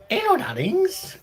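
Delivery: a sudden very high, sing-song hello; camp high greeting. Pretend. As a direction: sweep up into a theatrical falsetto greeting. Greeting viewers in a put-on voice.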